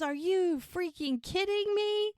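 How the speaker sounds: high, slow, exasperated mock yell; high-pitched drawn-out outrage; mock scream. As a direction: imitate yelling at the sky without full volume. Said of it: "screams into the sky".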